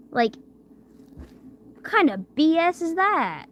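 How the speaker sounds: ordinary delivery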